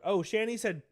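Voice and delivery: ordinary talk, nothing marked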